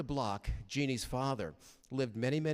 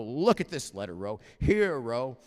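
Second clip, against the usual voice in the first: insistent call; 9 dB louder than usual. Retold or imitated. Showing wife the angry letter.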